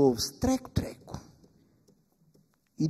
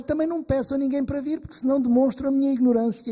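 anxious rush; 9 semitones higher, 5 dB louder, faster than usual. Acted. Worried young wife pours out trouble.

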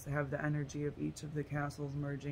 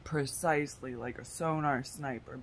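ordinary talk

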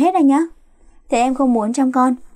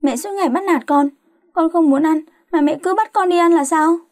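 high-pitched whiny complaint; petulant griping at being bullied; grumbling; 6 semitones higher than usual. A narrator's reading. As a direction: protest like a put-upon daughter. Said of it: "đừng có cằn nhằn nữa".

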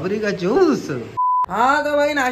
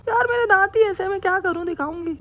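mimicking wife; 10 semitones higher than usual. Husband mimics his wife to the camera, high falsetto. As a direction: squeak it out like a pouty wife. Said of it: "mimicry कर रहा हूँ मैं".

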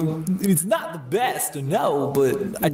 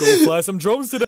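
louder, higher cartoonish president; Yogi Bear-like Obama; 5 semitones higher, 6 dB louder than usual. Comic mocking Obama to the others, loud Yogi Bear-like impression. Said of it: "sounds like Yogi Bear".

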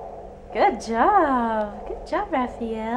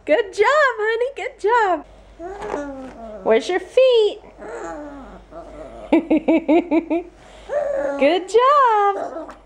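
high sing-song praise; baby talk praise; 8 semitones higher, slower than usual. Acted. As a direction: praise the baby like a proud mom.

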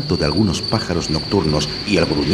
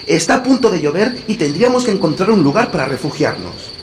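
put-on boy voice=76; 11 semitones higher, 4 dB louder than usual. A narrator's reading. Hansel talks to sister.

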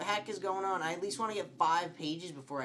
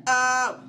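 loud, high "oh"; startled yell; 9 semitones higher, 12 dB louder than usual. Real, not acted. Player jumps at game scare, startled yell. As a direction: burst out in shock.

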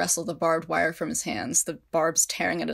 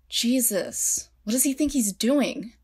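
high, loud stunned exclamation; high, loud shocked disbelief; shocked outburst; 6 semitones higher, 3 dB louder, slower than usual. A narrator's reading. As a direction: react in disbelief at the wall. America shocked at the wall.

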